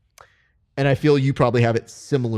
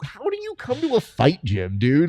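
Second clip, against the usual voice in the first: much higher, louder disbelieving rant, speeding up; exasperated coach; 3 semitones higher, 5 dB louder than usual. Retold or imitated. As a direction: blow up at a lazy student. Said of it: "and I'm like".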